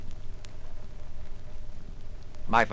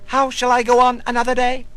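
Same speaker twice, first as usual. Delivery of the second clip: urgent inner question; 8 semitones higher, 6 dB louder, faster than usual. Acted. Quoted tormenting inner question.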